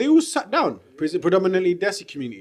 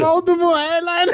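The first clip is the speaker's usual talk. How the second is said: mock high voice; 9 semitones higher than usual.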